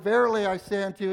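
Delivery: fast, throwaway "Verily, I say unto you"; rushed through. Shown as a demonstration. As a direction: rattle it off quickly. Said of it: "So it wasn't like".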